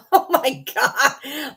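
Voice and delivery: slow laughing "oh my God"; slow, drawn-out laugh. Real, not acted. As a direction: laugh through the words. Laughing after being called ass.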